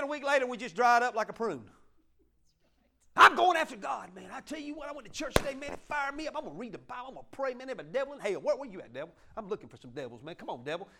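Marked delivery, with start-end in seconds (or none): 3.2-10.9 hammed-up eager believer, higher; mocking a churchgoer pumped up after service; mock fired up. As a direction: swagger like someone fresh from church, looking for a fight. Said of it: "you just fired up".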